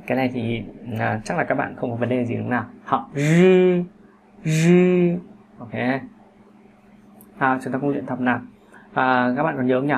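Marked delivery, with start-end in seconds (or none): 3.2-5.1 "rư" with curled tongue and buzz, held high; retroflex R. Shown as a demonstration. Teacher models the last initial, "rư" as voiced retroflex. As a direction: buzz "rư" on a level high pitch. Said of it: "vị trí vẫn như vậy".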